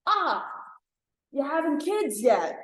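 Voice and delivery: high, needling family question; pry about babies in a lilting high voice; hammed-up nagging relative. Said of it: "the way they say it".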